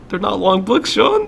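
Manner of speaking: high, incredulous retelling of a scolding; mock-scolding a brother, pitch soaring; exasperated retort. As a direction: push the name way up in mock exasperation.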